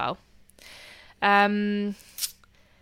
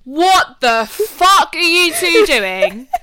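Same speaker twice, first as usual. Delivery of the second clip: higher, louder, slow disbelieving yell; retold outraged shout; 7 semitones higher, 7 dB louder, slower than usual. Sister yelling at brother over spilled smoothie, outraged. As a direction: yell it at them slowly in disbelief. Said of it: "And I'm like".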